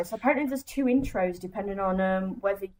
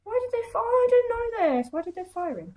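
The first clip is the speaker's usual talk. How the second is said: shocked; 12 semitones higher than usual. Real, not acted. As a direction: demand why, stunned. Shocked at news of firing.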